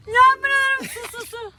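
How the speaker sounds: urgent complaint. Real, not acted. Prank victim complaining of water, urgent yelp. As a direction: cry out about being wet.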